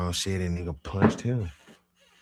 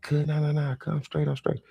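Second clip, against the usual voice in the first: high-pitched protest; 9 semitones higher, 3 dB louder than usual. Retold or imitated. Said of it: "He like".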